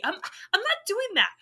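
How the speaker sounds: high-pitched mock-indignant protest; indignant refusal. Indignant refusal after mock demonstration.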